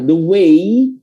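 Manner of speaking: "way" stretched to end in an "ee" glide; slow "the wayyy", rising; final I sound. Shown as a demonstration. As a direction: draw out the end of "the way" slowly. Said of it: "di sini tuh I".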